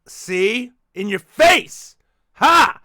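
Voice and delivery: loud, slow triumphant taunt; gloating shout. Retold or imitated. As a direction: crow it like you won. Gloating at daughter.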